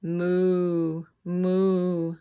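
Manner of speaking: lowing cow imitation; cow moo. Shown as a demonstration. Animal sound quiz for kids.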